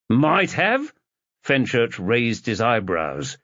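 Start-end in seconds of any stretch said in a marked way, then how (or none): 0.1-0.9 Might have? pushed higher with a rising disbelieving question; incredulous echo. Acted. Fenchurch throws Liam's hedge back at him, incredulous.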